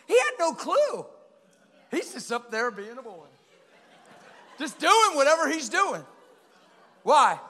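loud, high, fervent preaching burst; excited preaching. Real, not acted. Preacher shouting with delight about the boy. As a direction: let it explode with joy.

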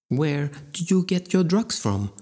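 a menacing politeness; polite. A narrator's reading. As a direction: ask it with icy courtesy. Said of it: "she asked politely".

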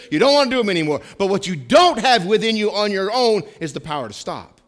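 high, loud, driving sermon delivery; impassioned preaching. Real, not acted. Preacher to congregation on conscience after being saved, impassioned. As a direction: preach it hard, hammering each key word.

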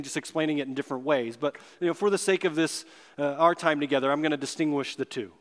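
ordinary talk, nothing marked

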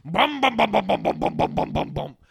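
loud, rhythmic car thumping; pounding car noise, voiced by mouth; imitated car. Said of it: "a car going, like".

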